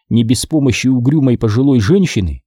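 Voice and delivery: ordinary delivery, nothing marked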